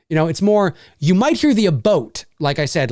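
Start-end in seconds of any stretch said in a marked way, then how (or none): 1.7-2.2 "about" said as "a boat"; long "oh" vowel in about; Newfoundland-accented about, like a-BOAT; Newfie "about". Shown as a demonstration. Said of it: "with the Newfie accent".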